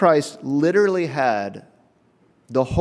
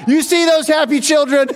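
very high, loud mock boast; triumphant shout; 13 semitones higher, 7 dB louder than usual. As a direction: crow it out with hammed-up pride. Mock-triumphant boast about the GameCube.

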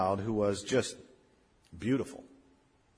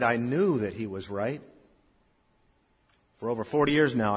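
normal talking voice

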